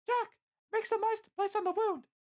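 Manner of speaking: very high falsetto command; Licia giving orders. A narrator's reading. Licia orders Jack to treat the wound, urgent falsetto. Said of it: "Licia orders".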